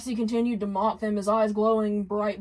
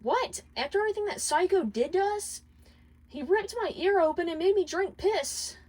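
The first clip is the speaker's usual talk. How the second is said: higher, indignant whine; open complaint; 10 semitones higher than usual. Acted. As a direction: protest the plan outright. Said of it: "Luna openly complained".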